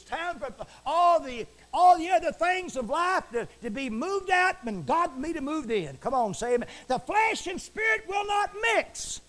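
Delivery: high, loud, full-force preaching; shouting preacher. Acted. Preacher condemning idol gods to his listeners, shouting. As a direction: thunder the commandment at the congregation.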